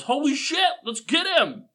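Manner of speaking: high startled thug shout; goon. Acted. Game master voices a goon spotting the intruder, high and alarmed. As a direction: yell it like a surprised henchman. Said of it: "one of the side goes".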